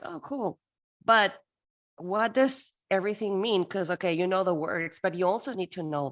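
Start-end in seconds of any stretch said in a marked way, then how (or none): 0.0-3.6 high bright "oh cool" turning into a question; mock delighted then puzzled. Interpreter acting a student facing new words, high and puzzled. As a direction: play an eager learner who suddenly gets lost. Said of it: "And then you go like".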